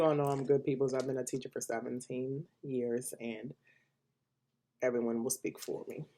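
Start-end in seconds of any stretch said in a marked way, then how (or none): none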